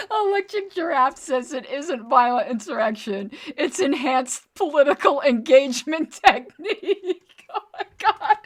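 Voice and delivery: high, loud, cracking up while reading aloud; reading through laughter. Real, not acted. Host reading Electric Giraffe's joke, in helpless laughter. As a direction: barely get the quote out for laughing. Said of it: "I shouldn't laugh".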